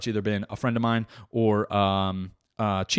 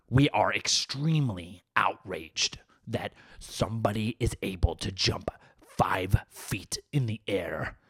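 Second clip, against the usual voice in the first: low, slow, whispery indignant activist; put-on hushed outrage; mock outrage; 3 semitones lower, 3 dB quieter than usual. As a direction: seethe slowly, as if gravely offended. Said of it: "I'm surprised there's no outrage at that".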